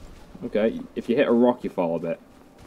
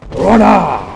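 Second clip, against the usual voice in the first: loud, explosive high battle shout; shouted power word; 6 semitones higher, 9 dB louder than usual. Pretend. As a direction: bellow it like a magic attack.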